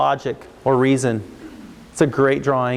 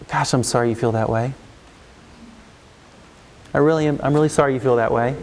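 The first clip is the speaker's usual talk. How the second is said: calm validation; 3 semitones lower than usual. Shown as a demonstration. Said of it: "modelling calm validation".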